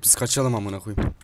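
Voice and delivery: normal talking voice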